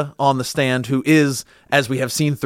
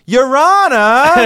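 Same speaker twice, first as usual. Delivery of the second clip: stretched-out, much higher and louder New York "Your Honor"; drawn-out, exasperated New York plea to the judge; New York accent; 11 semitones higher, 7 dB louder, much slower than usual. Retold or imitated. Fan mimics the lawyer appealing to the judge, drawn-out New York whine. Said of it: "when the judge yells out what the thing, he goes".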